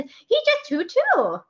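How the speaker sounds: put-on very high, slow little-girl voice; tiny voice. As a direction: squeak it like a tiny child.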